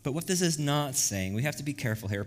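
ordinary talk, nothing marked

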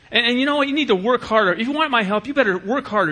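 put-on a dad snapping at his kid to leave him alone; irritated dad. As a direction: shove the kid away impatiently. Dismissive dad voiced.

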